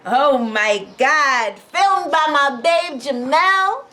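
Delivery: loud, slow, stretched-out elation; real overwhelmed excitement. Real, not acted. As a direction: draw out each word, overjoyed. Overwhelmed by the sale.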